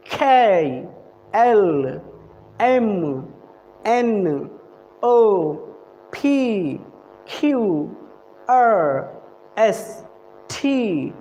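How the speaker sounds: letters spaced out one by one; slow teacher alphabet drill; each letter with a falling tone; reciting the alphabet. Shown as a demonstration. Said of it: "मैं करके आप लोगों को दिखाता".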